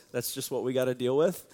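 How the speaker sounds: usual speaking voice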